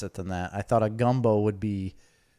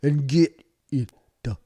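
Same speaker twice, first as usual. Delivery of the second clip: put-on higher, louder, slow Louisiana accent; mock Louisiana accent; 6 semitones higher, 6 dB louder, slower than usual. Hamming up Louisiana talk for a friend, loud slow Louisiana accent. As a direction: belt it out like a swamp-country Cajun.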